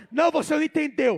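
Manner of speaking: loud, quick interjection; full-force preaching. Real, not acted. Preacher telling the congregation they did not understand, loud urging.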